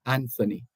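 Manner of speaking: "Anthony" with th as in thin; sounded TH. Shown as a demonstration. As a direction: say "Anthony" as AN-thuh-nee. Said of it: "how he pronounces his name".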